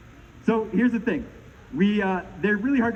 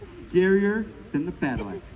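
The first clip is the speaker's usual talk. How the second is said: ordinary talk, nothing marked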